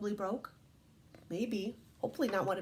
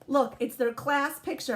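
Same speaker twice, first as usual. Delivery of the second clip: ordinary delivery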